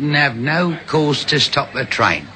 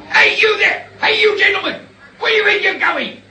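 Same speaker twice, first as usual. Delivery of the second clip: angry shout. Acted. Railway guard shouting after the two fleeing gentlemen. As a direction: yell after them as they run off.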